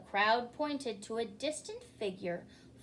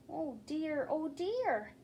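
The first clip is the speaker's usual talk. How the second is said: worried; 5 semitones higher, slower than usual. Acted. Worried host aside.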